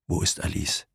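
softly voiced, understated tremble; trembling voice. A narrator's reading. Injured man asks after Alice, mildly trembling. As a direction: ask quietly with a touch of tremor. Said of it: "fragte er mit zitternder Stimme".

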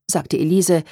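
usual speaking voice

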